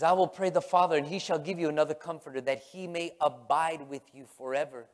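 driving, preached scripture quote; emphatic scripture reading. Acted. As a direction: read the verse out forcefully without lingering. Rereads the scripture with force.

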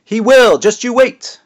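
shrill-high, loud princess cry; very high-pitched voice. A narrator's reading. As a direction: push the voice way up. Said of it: "in an unnaturally high-pitched voice".

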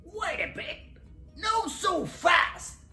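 bristling. A narrator's reading. Echidna halts Dingo's cooking, bristling. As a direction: cut in with high-pitched indignation. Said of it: "he bristled".

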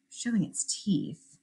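ordinary delivery

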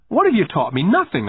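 put-on high, emphatic boyish retort; acted anger from a wronged kid; angry retort. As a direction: throw it back at him angrily. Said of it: "I said angrily".

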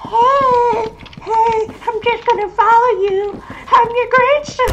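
very high, loud falsetto; put-on shrieky little-kid voice; squeaky grandson voice. Grandson following someone around, squeaky pretend voice.

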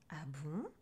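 low, curious little question; intrigued witch. A narrator's reading. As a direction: ask it quietly, with a long rise. Flattered witch, growing interest.

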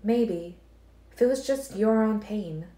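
put-on Alice cautioning Edward about the girl's blood; Alice, warning. A narrator's reading. Alice voiced.

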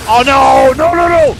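loud, high yelling no; panicked shout. Real, not acted. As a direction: scream it in panic as you're hit. Enemy attacking in game.

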